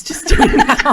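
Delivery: laughing through the words. Real, not acted. Laughs at herself.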